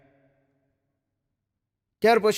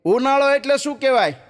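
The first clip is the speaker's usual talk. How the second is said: stern teacher; 6 semitones higher, 4 dB louder than usual. Acted. Teacher asks again, louder, higher.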